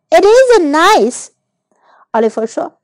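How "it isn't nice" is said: put-on high, slow, drawn-out childish retort; stubborn little boy voice; sulky contradiction. A narrator's reading. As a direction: disagree stubbornly, stretching the words. Oliver rejects helping each other.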